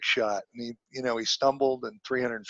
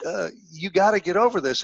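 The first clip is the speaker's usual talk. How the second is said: a mentor's voice lifted higher, gently firm; higher voiced mentor; 6 semitones higher than usual. Old mentor reassures a humiliated young man, higher voice. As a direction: play the old mentor talking sense into a kid.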